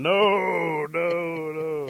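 loud, high drawn-out no's; urgent cry of refusal, stretched out; alarmed refusal. Acted. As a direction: wail each no long and high, warning someone off. Parents warn him off, shaking.

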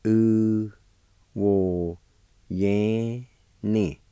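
"U wo ye ni" in held, separated syllables. Shown as a demonstration. Teacher showing learners the word hand, syllable by syllable. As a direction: pause between the sounds of "U wo ye ni". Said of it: "it's pronounced like this".

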